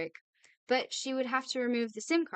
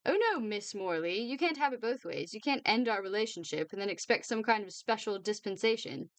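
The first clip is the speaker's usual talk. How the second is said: ordinary delivery, nothing marked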